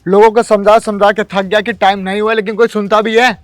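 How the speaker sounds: angry outburst. Acted. Overworked guard venting at an innocent candidate, angry and loud. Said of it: "आप गुस्सा क्यों हो रहे हैं".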